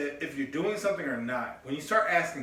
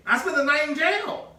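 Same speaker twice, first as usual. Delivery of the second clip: much higher and louder, incredulous; outraged disbelief; 10 semitones higher, 5 dB louder than usual. Real, not acted. Telling his cohost about jail, outraged and high. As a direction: hit the punchline in outrage.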